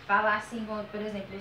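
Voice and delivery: ordinary delivery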